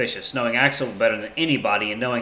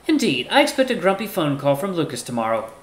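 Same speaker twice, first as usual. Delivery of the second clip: higher-pitched, measured formal character; courteous Ty; 6 semitones higher than usual. Acted. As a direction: speak up high, politely composed. Ty replies in character voice.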